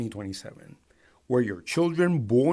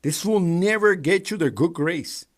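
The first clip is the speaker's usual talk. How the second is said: angry warning; 8 semitones higher, 6 dB louder than usual. Real, not acted.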